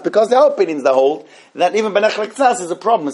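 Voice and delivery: normal talking voice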